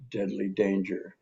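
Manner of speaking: ordinary talk, nothing marked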